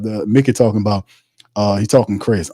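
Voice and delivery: normal talking voice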